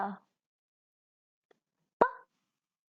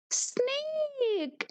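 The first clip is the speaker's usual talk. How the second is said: Snake said higher, like a lively teacher; 8 semitones higher than usual. Shown as a demonstration. Teacher repeating Snake to children, said higher. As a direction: lift the word Snake high.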